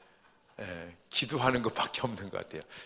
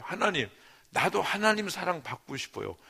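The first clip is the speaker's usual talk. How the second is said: pleading prayer; 7 semitones higher than usual. Retold or imitated. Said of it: "뭐, 이런 기도를".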